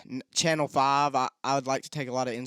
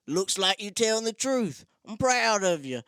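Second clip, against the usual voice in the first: higher mock cop voice, approving; imitating the cop; 6 semitones higher than usual. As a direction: play the cop letting him off, higher.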